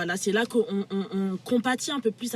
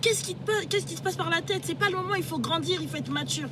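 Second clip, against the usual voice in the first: higher, urgent pleading voice; pleading friend; 8 semitones higher than usual. Retold or imitated. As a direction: beg him to grow up, voice raised. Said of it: "il lui explique".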